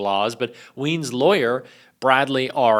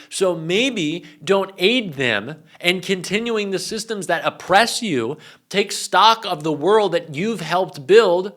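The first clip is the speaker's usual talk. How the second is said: raised pitch, drawn-out, forceful words; exasperated rant; 6 semitones higher, 4 dB louder, slower than usual. Real, not acted. Urging conservative women to stop undermining their gender, ranting. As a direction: build the rant, punching each demand. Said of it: "this is a bit of a rant".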